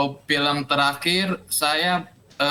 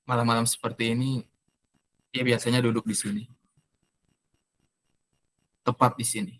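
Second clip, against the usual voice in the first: fake crying; 4 semitones lower than usual. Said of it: "bisa acting nangis nggak?".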